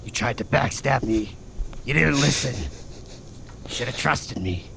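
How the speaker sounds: Moriarty. Acted. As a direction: menace it like a tough evil guy, deep and deliberate. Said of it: "Tough evil Moriarty".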